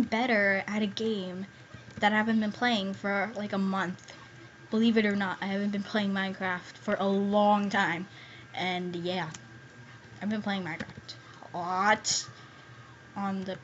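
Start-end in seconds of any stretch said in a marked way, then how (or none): none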